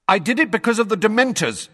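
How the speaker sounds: loud, fast, high outburst. A narrator's reading. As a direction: blurt it loudly before interruption. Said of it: "he said loudly".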